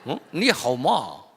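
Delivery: slow, drawn-out mock Chinese accent on 칠 점 팔 기. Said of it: "좀 중국말 하는 것 같지 않아요?".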